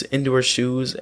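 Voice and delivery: plain reading voice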